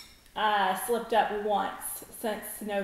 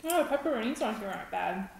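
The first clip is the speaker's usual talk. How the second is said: speaking between bites; talking while chewing; much faster than usual. Real, not acted. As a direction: mouth partly full of pizza. Seen 0: Left woman holds slice, mouth working food after earlier bites.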